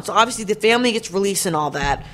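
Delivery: ordinary talk, nothing marked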